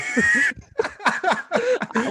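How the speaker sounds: a burst of laughter; laughing. Real, not acted. Laughs at his own story.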